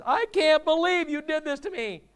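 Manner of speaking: high, loud frantic yell; screaming at God. Retold or imitated. Drowning man yells up at God in disbelief. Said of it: "and he says".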